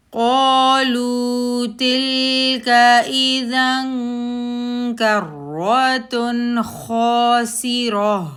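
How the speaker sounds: slow, loud, high melodic tarteel; Quran recitation. Shown as a demonstration. Teacher models the verse for students in a loud, slow, high chant. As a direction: sing out the verse with long vowels.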